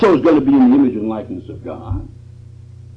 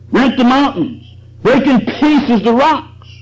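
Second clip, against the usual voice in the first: high, slow and forceful; dramatic preaching; 9 semitones higher, 4 dB louder, slower than usual. Acted. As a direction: let the wind break the rocks.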